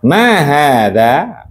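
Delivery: Ma hada said very slowly and clearly; slow model reading. Shown as a demonstration. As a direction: spell out Ma hada slowly for the class. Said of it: "menanyakan benda ya". (Ma hada dibaca sangat pelan dan jelas)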